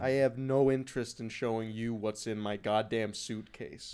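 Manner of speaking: low, slow, soft refusal; uncooperative suspicious traveller. Acted. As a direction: play a cagey passenger, dropped low and slow. Plays traveller refusing a search.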